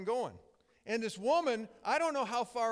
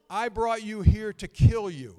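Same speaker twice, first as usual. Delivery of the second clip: loud menacing threat; 12 dB louder than usual. Retold or imitated. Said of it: "He says".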